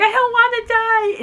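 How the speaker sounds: high, panicky little whine; mock-scared high plea. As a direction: beg for your life, voice jumping up. Scared Book begs Savage.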